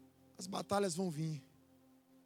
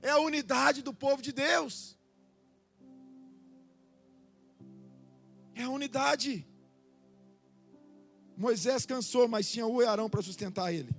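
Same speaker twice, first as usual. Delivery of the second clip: loud, higher fervent preaching; 4 semitones higher, 9 dB louder than usual. Acted. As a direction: preach with rising force. Preaching builds again.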